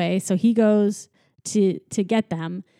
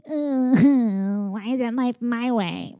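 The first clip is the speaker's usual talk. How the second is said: put-on whiny baby; 3 semitones higher, much slower than usual. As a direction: whine like a spoiled baby.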